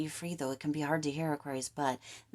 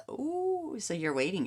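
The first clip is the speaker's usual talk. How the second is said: ordinary delivery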